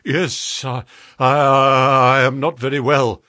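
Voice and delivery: faltering, stammered reply; a touch of distress, halting on the first words; struggling for composure. A narrator's reading. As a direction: try to pull yourself together. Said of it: "making obvious efforts to pull himself together".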